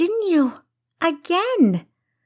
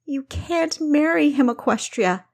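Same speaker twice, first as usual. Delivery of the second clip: "marry" hit hard, high and loud, falling; emphatic; 10 semitones higher, 3 dB louder than usual. Acted. Kessa forbidding her sister's marriage, "marry" stressed high. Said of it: "she said emphatically".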